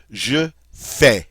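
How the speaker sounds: "je fais" said zhuh FAY, final S silent; French je fais. Shown as a demonstration. Teacher modeling "je fais" for students, silent final S. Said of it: "Notice we don't say that final S".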